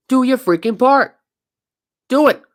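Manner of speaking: telling the player to honor his deal, shouting; demanding shout. Real, not acted. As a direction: bark the order at him. Ranting at the player.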